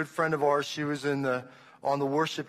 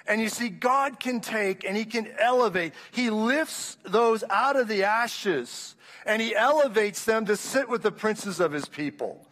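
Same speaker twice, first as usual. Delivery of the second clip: building preaching; 3 semitones higher than usual. Real, not acted. Preacher rising to climax.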